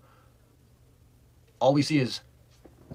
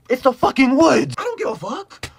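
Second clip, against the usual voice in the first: much higher, loud, slow indignant yell; exasperated outburst; 12 semitones higher, 8 dB louder than usual. Real, not acted. Storyteller venting at cops searching the woods, loud indignant yell. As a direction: explode at how absurd it is.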